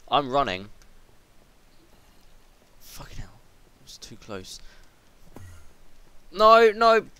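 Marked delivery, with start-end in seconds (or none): 2.8-4.6 hushed whisper, hiding from danger; real tense whisper. Real, not acted. Hiding from nearby mob. 6.3-7.0 high, loud frantic pleading; real fright screams; terrified screaming. Real, not acted. Mob attacking up close.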